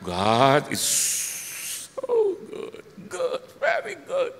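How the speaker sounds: loud start leaping very high; mock praise. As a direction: swoop up into a high, drawn-out praise. Breaks into a known praise phrase.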